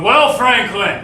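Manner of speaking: slow, loud, drawn-out groan of a father; a fed-up father groaning his son's name; groaning dad. Acted. Dad groans at Frank as he walks in. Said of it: "Dad groaned".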